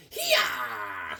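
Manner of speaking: barbarian battle cry. Acted. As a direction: swing the axe. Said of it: "He's like".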